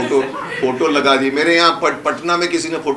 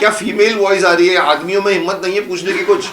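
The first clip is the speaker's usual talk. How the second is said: loud, teasing, higher call-out; playful teasing; 7 semitones higher, 8 dB louder than usual. Real, not acted. Teasing that only a woman asks. (मज़ाकिया ताना, ऊँची आवाज़ में)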